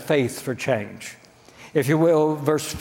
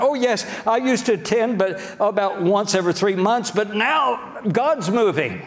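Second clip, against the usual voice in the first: critics jumping on board; 6 semitones higher than usual. Acted. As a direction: imitate a critic eagerly claiming a part in it. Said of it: "They'll say something like".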